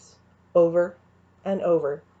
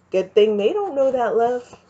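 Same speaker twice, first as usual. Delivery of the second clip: louder, calling across the room; called out; 5 semitones higher, 5 dB louder than usual. A narrator's reading. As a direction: call it after her, teasing. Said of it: "Cain called out".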